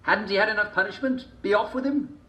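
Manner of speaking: high, scornful townsfolk voice; villagers warning. A narrator's reading. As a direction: shoo him off like a nuisance. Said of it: "gathered to warn him off".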